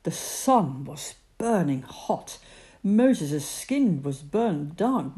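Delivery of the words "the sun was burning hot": ordinary delivery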